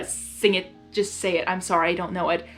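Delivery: ordinary delivery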